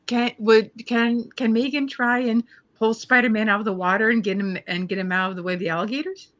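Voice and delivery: usual speaking voice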